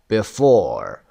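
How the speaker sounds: before with a strong, falling FORE; stress on second syllable. Shown as a demonstration. Teacher giving an example to learners, second-syllable stress on before. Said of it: "stressed on the second syllable".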